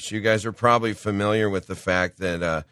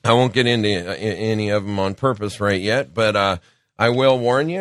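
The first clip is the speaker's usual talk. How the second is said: usual speaking voice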